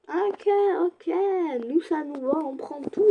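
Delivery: enthousiasme qui monte dans l'aigu; réjouis-toi d'une voix haut perchée; ravie. Real, not acted. Ravie de ses achats. (joie aiguë et enjouée)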